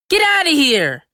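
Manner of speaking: high, loud yell; furious yell. Acted. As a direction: shove the words out. Boy wriggling from brother's grip.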